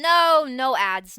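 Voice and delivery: loud, drawn-out real protest. Real, not acted. Streamer protesting an ad starting, loud and drawn-out. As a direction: cry out to stop it, stretching the words.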